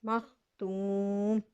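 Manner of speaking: मख्तूम slowly, ending on a still मीम; pausal form. Shown as a demonstration. Teacher demonstrates the stop, slow recitation. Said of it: "वक्फ की हालत में पढ़ेंगे मख्तूम".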